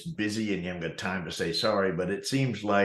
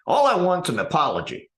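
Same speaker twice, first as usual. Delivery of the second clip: raised, higher, louder demanding tone; indignant demand; 7 semitones higher, 6 dB louder than usual. Retold or imitated. Angry customer to the manager, loud indignant demand. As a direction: insist on it like a wronged customer. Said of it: "and he's like".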